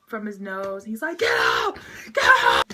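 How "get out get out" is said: high, very loud shouting; freaked-out scream. Retold or imitated. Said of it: "the dude freaks out".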